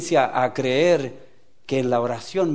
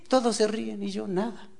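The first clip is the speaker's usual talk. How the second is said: imitated sad woman; 5 semitones higher than usual. Preacher quoting the joyless woman left out, mildly mournful. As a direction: lament being left out.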